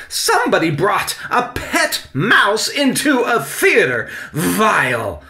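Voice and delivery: high, loud, melodramatic tirade; outraged blurting. A narrator's reading. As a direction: shriek in horror at the disaster. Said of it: "Victoria waved her yellow clipboard and blurted".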